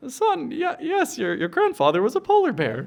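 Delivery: high, patient puppet mother voice; mother polar bear. Acted. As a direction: reassure the cub in a high puppet voice. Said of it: "And the mom goes".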